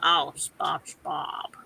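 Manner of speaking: pinched-nose Squidward. Shown as a demonstration. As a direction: hold your nose and sigh it like Squidward. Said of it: "hold my nose".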